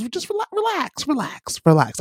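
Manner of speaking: very high, soft relax stepping down; friendly relax. Acted. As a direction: start way up high and ease down to reassure. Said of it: "when someone goes".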